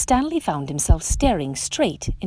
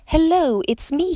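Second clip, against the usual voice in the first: high, louder joyful call; 9 semitones higher, 4 dB louder than usual. A narrator's reading. Stanley calling to his friends at last, high and joyful. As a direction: call out happily to found friends. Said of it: "he called".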